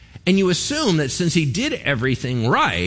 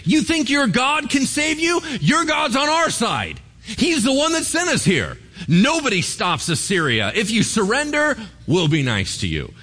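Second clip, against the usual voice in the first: mock yelling taunt; 10 semitones higher than usual. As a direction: sneer it up at the walls like a bully. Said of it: "he starts yelling at 'em".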